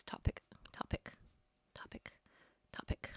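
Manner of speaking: fading unvoiced whisper. Real, not acted. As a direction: whisper the word to yourself. Whispering while thinking of topic.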